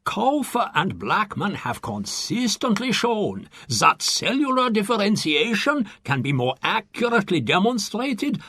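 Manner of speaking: a fussy German professor correcting everyone; indignant German scientist. Acted. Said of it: "The German scientist ... remarks".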